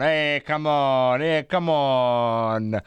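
slow, drawn-out Italian-English come on; hammed-up Italglish; Italian-accented English. Shown as a demonstration. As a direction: lay on a thick Italian accent. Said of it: "a me piace parlare in inglese".

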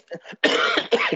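rough hacking cough; chesty cough. Real, not acted. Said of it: "Chiedo scusa, ma la tosse chiaramente".

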